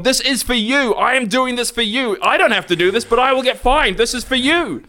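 high, insistent pleading; exasperated taxpayer explaining himself in an email, high; mock pleading explanation. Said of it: "I even had to explain".

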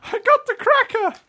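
very high falsetto celebrity impression; Emily Blunt. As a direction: squeak it in a silly high voice. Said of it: "It's me, Emily Blunt".

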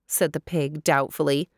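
usual speaking voice

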